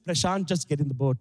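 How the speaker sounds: low put-on mum voice; acted low, deadpan instruction; imitated mum. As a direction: play a mum ordering her husband, pitched low. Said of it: "And then my mum".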